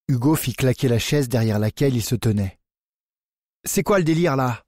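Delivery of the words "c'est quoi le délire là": angry shout. A narrator's reading. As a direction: hurl the question at Bertrand. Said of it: "hurla-t-il".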